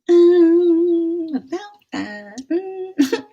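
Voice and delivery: very high drawn-out hedging; awkward stalling. Retold or imitated. Replying about tomorrow's date, comically awkward stalling. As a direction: squirm out of it in a high, stretched voice. Stalling when asked if still on.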